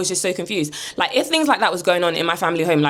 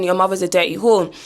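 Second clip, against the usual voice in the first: ordinary delivery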